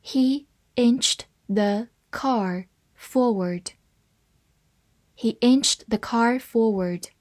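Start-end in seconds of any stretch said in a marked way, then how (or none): 0.0-3.7 very slow, word-by-word reading. Shown as a demonstration. English teacher models the sentence for learners, reading slowly. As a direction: leave a clear gap after each word. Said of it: "慢慢来读".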